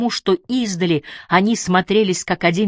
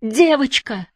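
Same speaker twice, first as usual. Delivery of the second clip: high, pleading alarm; desperate cry; 8 semitones higher, slower than usual. A narrator's reading. Barbara pleads for the drowning girl, high desperate cry. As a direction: plead high for the child's life. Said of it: "задохнулась от крика Барбара".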